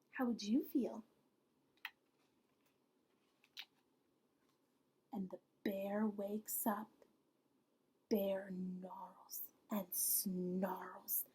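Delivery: very soft whisper, slowing down; suspense whisper. A narrator's reading. Narrator whispers to the children as the bear wakes. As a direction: hold your breath as the bear wakes.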